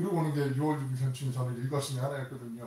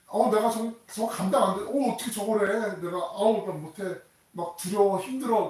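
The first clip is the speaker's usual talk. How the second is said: panicked fear; 7 semitones higher, 5 dB louder than usual. Acted. Person overwhelmed, panicking.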